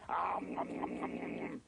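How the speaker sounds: growly rapid nom-nom munching; noisy gobbling. Acted. Monster eats the cookie, greedy noisy munching.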